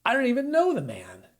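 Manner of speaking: high, loud and forceful denial; adamant denial. A narrator's reading. Peter denying Jesus to a servant girl, forceful and loud. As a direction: snap the denial back. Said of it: "Peter adamantly denied it".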